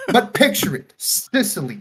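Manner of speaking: high, loud hammed-up mob boss; mock Jersey mobster. Mob father at his daughter's wedding, hammed-up Jersey accent. Put-on voice continuing the bit.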